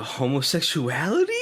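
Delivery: homosexuality rising into a question; trailer teaser echo. Retold or imitated. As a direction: echo the mock-trailer line with a lifted ending. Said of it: "in the trailer I had a little bit of".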